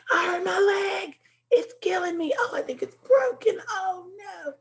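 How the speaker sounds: high, crying out in mock pain; dramatic pained exclaiming. Shown as a demonstration. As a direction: overact a hurt leg, wailing. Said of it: "You'd probably be more excited than normal".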